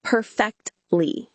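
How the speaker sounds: Perfectly said slowly with a falling tune; slow model word. Shown as a demonstration. Teacher models Perfectly for the listener, slow and careful. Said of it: "and perfectly became".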